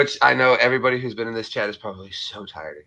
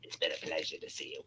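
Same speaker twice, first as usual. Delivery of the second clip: Mrs. Bighead; 6 semitones lower, 3 dB quieter than usual. Acted. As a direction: gush at the neighbour in an exaggerated character voice. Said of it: "Hello, Missus Bighead".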